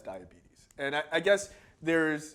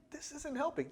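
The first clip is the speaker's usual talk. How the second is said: put-on high, soft child's protest; small, high-pitched mock complaint; complaining child; 12 semitones higher, 9 dB quieter than usual. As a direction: play a hurt kid quietly objecting. Voicing himself as burned child.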